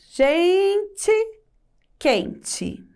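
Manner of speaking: "gente, quente" with GEN and QUEN leaned on, weak "tchi" endings; stressed first syllable. Shown as a demonstration. Teacher showing pupils the tonic syllable, GEN and QUEN stressed in "gente, quente". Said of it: "A sílaba tônica é o gen e o quem".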